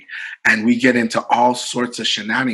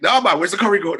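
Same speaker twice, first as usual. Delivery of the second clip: higher-pitched mock patois; Jamaican patois; 7 semitones higher, faster than usual. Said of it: "this guy's talking patois".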